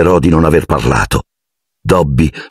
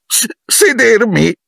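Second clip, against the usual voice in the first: very high, slow stammered wail; moaning; slower than usual. A narrator's reading. House-elf aghast at being asked to sit, wailing high. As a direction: whimper it in horror. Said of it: "gemette la creatura".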